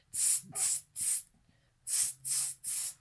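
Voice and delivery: soft hissed tsks in two bursts; monkey "tss" chatter. A narrator's reading. Monkeys answer the peddler from the branches, soft hissing. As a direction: imitate monkeys with quiet unvoiced hisses. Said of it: "they said".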